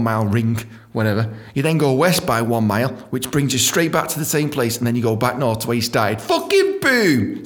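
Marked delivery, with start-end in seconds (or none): 6.2-7.4 high, drawn-out triumphant exclamation. Real, not acted. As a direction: land the answer like a big reveal. Triumphant end of puzzle answer.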